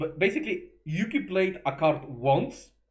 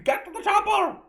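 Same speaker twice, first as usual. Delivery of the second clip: Schwarzenegger impression; 6 dB louder than usual. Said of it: "I cannot do a Russian accent".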